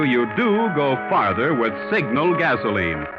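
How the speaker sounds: usual speaking voice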